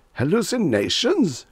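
high, incredulous echo of the word; shocked cry. A narrator's reading. Reggie cries out over the noisy bar, shocked disbelief. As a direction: throw the word back in disbelief. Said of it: "Reggie cried over the din".

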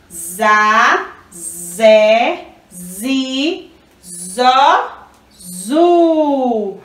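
za, zé, zi, zó, zu one by one with pauses; teacher chanting out za, zé, zi, zó, zu; syllable family recital. Shown as a demonstration. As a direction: recite "za, zé, zi, zó" separately, then stretch "zu". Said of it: "Então, nós temos za".

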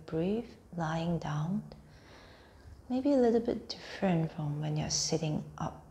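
unhurried meditation voice, phrase by phrase; guided meditation. Acted. Teacher guiding listeners lying on mats through a body scan, guided meditation.

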